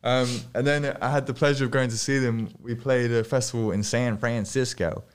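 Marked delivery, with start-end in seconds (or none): none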